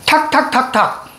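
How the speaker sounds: "탁탁탁탁" in four separate high taps; light staccato answer. Acted. Teacher playing a student's answer on poem reading, light staccato beats. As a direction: answer with "탁탁탁탁", slow and evenly chopped. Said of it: "시 어떻게 읽으래".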